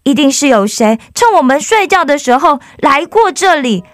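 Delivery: high excited shout of discovery. Acted. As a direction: call everyone over, thrilled. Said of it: "罗拉大喊说". (兴奋地大喊)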